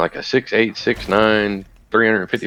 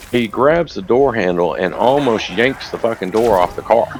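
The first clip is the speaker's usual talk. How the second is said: ordinary talk, nothing marked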